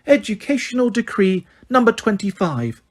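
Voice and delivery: high, sugary correction; simpering. Acted. Umbridge correcting McGonagall, simpering. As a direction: simper the name.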